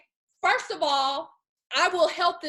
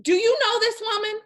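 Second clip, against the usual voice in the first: outraged; 9 semitones higher, 6 dB louder than usual. Real, not acted. Outraged at the preacher's greed.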